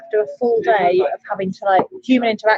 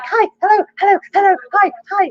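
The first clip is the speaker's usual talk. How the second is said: high, loud string of eager hellos; overeager greetings; 9 semitones higher, 6 dB louder than usual. Retold or imitated. Bartender mimicking suddenly having chats with customers, overeager greetings. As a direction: imitate greeting person after person, pitched right up. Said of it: "to suddenly being like".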